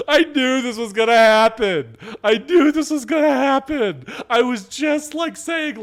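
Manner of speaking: high, loud wail through laughter; tearful, squealed disbelief; laughing to tears. Real, not acted. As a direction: cry it out in real hysterics. Helpless hysterics at a game glitch.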